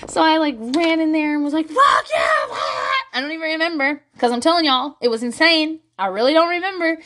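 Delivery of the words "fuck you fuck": high, loud reenacted scream; shrieked mock rage; retold screaming. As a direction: scream it as in a furious fight. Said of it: "a lot of violent screaming".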